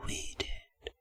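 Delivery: eerie whispered confession; creepy whisper. Acted. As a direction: breathe it out like a sinister secret.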